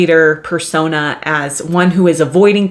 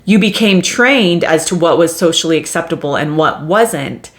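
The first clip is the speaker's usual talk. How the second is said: ordinary talk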